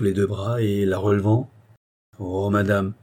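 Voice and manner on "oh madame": ordinary delivery, nothing marked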